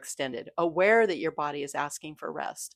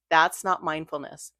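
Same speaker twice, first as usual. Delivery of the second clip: normal talking voice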